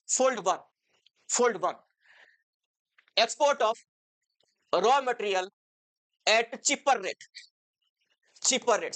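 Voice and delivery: word by word with long pauses; measured chunks, pausing to write; dictation. Real, not acted. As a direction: dictate notes for students to copy. Dictating notes while writing them out.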